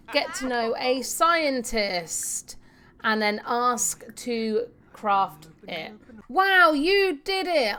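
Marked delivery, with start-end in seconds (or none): 6.3-7.8 excited praise. Acted. Reading a game character's congratulation.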